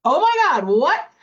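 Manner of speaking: loud, high shocked gasp of disbelief. Retold or imitated. Dieter seeing whipped cream on the pantry list, shocked squeal. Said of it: "And I was like".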